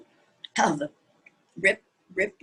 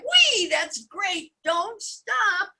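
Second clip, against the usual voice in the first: delighted frog cry; 10 semitones higher, slower than usual. A narrator's reading. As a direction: cheer them on like a thrilled frog. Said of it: "frog cried".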